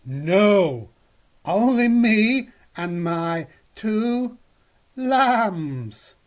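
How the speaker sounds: sheep voice. Acted. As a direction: answer like a motherly sheep. The sheep answers Goz.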